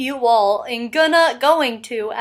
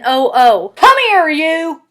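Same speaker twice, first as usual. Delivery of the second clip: Southern accent; 6 dB louder, slower than usual. Shown as a demonstration. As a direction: call them over in a Southern twang. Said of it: "pronounced more like an oo".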